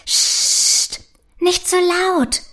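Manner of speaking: a mild, alarmed shush, slow; startled hush. A narrator's reading. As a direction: hush her quickly, a touch frightened. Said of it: "sagt Prinzessin Arabella erschrocken".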